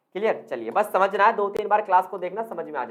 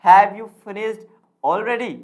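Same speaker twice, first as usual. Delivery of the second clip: loud, deliberate question with a pause before raised "already"; stress on already; 7 dB louder, much slower than usual. Shown as a demonstration. Teacher demonstrating rising intonation, "already" emphasized. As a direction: set "already" apart and push it up. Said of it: "Already सबसे तेज बोलेंगे हम पूरे sentence में".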